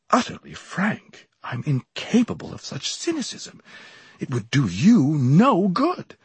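anxious gentleman warning a visitor; earnest warning. A narrator's reading. Emerson voiced, pressing his warning.

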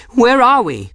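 high, loud anxious call; smoke-strained cry. A narrator's reading. Woman lost in smoke asks her companion, high cry. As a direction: call out high over the fire. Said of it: "her voice frail and rough with smoke".

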